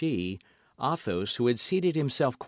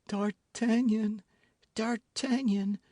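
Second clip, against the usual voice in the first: high, slower faint calling; weak cry; 10 semitones higher, slower than usual. A narrator's reading. Constance dying, calling D'Artagnan. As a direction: call out weakly. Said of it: "cried Madame Bonacieux".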